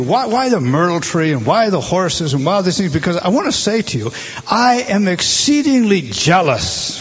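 loud, fervent preaching, voicing God's jealousy; full-force preaching. Preacher to congregation, voicing an angry God, loud and fervent. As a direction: thunder it from the pulpit like God himself.

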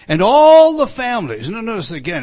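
loud fervent pulpit preaching; preaching at full force. Acted. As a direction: preach it hard to the congregation.

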